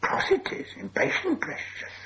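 breathy, high creature hiss; sibilant, squeaky creature voice; hissing Gollum. A narrator's reading. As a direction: hiss it through like an irritated cave creature. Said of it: "hissed Gollum".

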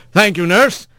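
leading the witness; lawyer voice. A narrator's reading.

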